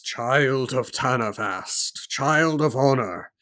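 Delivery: slow, drawn-out proclamation of a mysterious voice. Acted. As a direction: intone it slowly, like a voice calling someone by title. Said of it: "the voice says".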